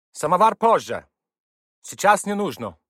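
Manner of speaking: high, loud call from behind a door; shouting through a door. A narrator's reading. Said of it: "крикнули ему из-за двери".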